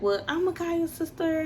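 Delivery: a hint of mom taking charge; mom's voice. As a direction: mom deciding to call the sister, lightly imitated. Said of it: "She was like".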